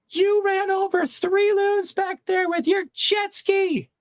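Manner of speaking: very high, slow shrill mock of an angry lady; lady yelling. Retelling the lady on the pontoon yelling about loons, high mock shout. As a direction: squeal it like the furious boater lady. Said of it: "the lady starts yelling at him".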